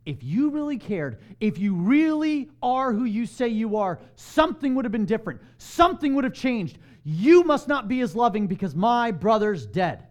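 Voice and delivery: loud, pleading accusation rising in force; emotional reproach. Retold or imitated. A grieving believer confronting Jesus over a dead brother, loud and aching. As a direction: hurl the hurt at Jesus. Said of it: "And I get emotional with Jesus".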